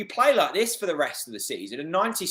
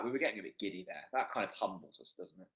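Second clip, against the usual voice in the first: quiet, deflated; 7 semitones lower, 13 dB quieter, faster than usual. Real, not acted. Calming after conceding.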